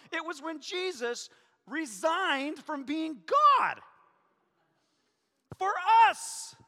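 slow, rising to a high loud peak; preaching climax. Acted. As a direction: build the point to a soaring cry.

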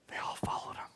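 hushed whisper; whispered reveal of the followers. Real, not acted. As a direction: breathe it out like a secret. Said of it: "dramatic hush".

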